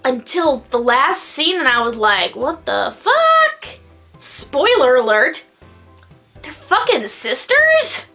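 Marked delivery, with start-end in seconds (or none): 2.3-3.8 very high, slow shocked disbelief. Retold or imitated. Retold shocked reaction. 6.4-8.1 high, slow incredulous question; disbelieving squeak. Real, not acted.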